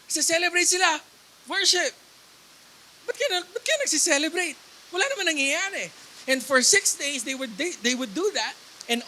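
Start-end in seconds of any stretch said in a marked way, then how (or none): 3.0-5.9 high, incredulous questioning; mock puzzlement. Preacher plays a doubter as nothing has fallen, mock puzzlement. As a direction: act the baffled doubter, pitched up.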